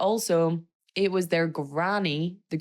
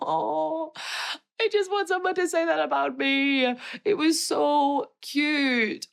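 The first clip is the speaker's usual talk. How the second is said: much higher, gushing and tearful; teary squeal; 11 semitones higher, slower than usual. Real, not acted. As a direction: squeak it out, overwhelmed with emotion. Moved by groom's speech.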